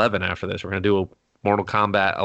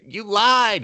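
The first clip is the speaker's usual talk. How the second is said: high, louder wounded whine; whiny ghost; 12 semitones higher, 4 dB louder than usual. Acted. Slimer on the steps accusing him, high whine. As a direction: whimper it like a betrayed pet.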